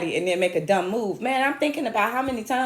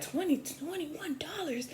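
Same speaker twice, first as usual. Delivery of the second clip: slow, much softer and higher, barely getting the price out; nervous mumble; 8 semitones higher, 14 dB quieter, slower than usual. Retold or imitated. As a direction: mumble it like you're dreading the total. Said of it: "she could tell that I was just like".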